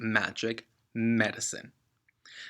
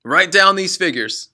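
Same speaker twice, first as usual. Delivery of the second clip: loud, higher barked order; commanding father; 7 semitones higher, 11 dB louder than usual. Acted. Father dictating figures to his son. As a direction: snap out the instruction.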